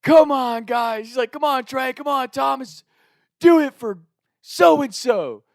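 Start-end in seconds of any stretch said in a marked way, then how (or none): 0.0-1.1 high-pitched cheering call; coach cheering. Retold or imitated. Coach cheering runners from the fence, high cheer. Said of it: "he's screaming the name". 1.3-5.4 high, loud rallying shout; rally them hard, calling out; coach screaming. Retold or imitated. Coach screaming from the crowd, loud rallying shout. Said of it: "he's screaming the name".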